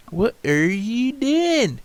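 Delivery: high, slow, drawn-out disbelief; loud incredulous what are you doing; baffled. Real, not acted. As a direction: stretch it out in shock at the screen. Baffled at a bus.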